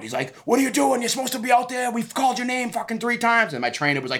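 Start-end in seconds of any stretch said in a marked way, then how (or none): 0.5-3.5 flipping out. Retold or imitated. Commission official yelling at the late fighter, high mock freakout. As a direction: imitate an official losing it at the fighter. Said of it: "was, like, flipping out".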